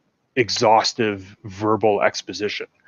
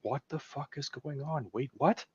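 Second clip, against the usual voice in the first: hushed, higher-pitched disbelief; much softer, as a stunned moviegoer; baffled near-whisper, pitched up; bewildered audience; 4 semitones higher, 14 dB quieter than usual. Acted. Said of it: "As the audience, you're like".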